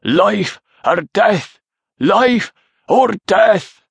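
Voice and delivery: high, slow, drawn-out battle cry; shouted war cry. A narrator's reading. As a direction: shout it as a clan rallying cry. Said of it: "the cry that his clansmen were shouting".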